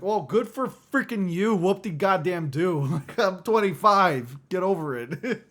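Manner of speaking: loud, high sarcastic blast; mock the classmates bitterly; sarcastic retort. Retorting to younger classmates about his age, loud sarcasm. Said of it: "I'm like".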